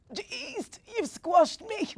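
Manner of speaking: high, loud wheezy cry, stretched slow; wheezing squeal. A narrator's reading. Grandma squashed when Bella climbs on her knee, wheezing high. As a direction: squeeze it out like the air's been crushed out of you. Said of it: "Granigan wheezed".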